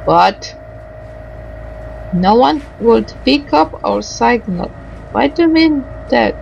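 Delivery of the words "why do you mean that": ordinary delivery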